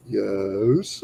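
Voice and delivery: low, soft, reluctant reply; grudging admission. Retold or imitated. Friend admits he feels better, low grudging answer. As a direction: give in quietly, voice dropped.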